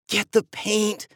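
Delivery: slow, huffy order; huffing. A narrator's reading. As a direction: grumble it out of breath. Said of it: "Eva huffed".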